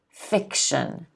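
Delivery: ordinary talk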